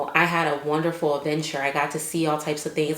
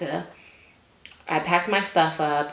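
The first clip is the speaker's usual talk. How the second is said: ordinary talk, nothing marked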